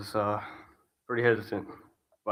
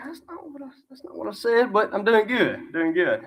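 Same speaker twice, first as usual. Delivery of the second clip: much higher, puzzled inner thought; bemused, play along in your head; a touch of confused self-talk answering anyway; 10 semitones higher than usual. Retold or imitated. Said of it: "In my mind, I was like".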